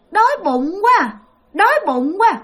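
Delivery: mock a hungry child whimpering; whiny child. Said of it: "cái cũng nít mà nhõng nhẽo". (giọng con nít nhõng nhẽo, cao)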